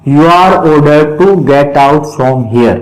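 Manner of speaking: slow, even word by word reading; slow dictation. Shown as a demonstration. Teacher dictating the converted sentence slowly to students. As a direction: give it slowly so they can write it. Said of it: "teacher dictating the answer".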